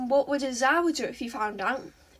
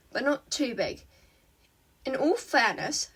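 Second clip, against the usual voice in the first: plain reading voice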